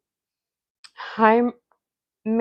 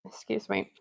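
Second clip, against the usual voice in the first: ordinary talk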